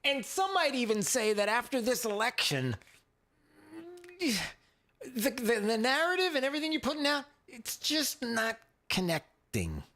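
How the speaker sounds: high, mock-casual boss letting her down; lightly hammed exec voice; imitating Comcast execs. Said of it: "Comcast was like".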